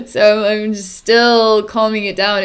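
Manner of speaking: ordinary delivery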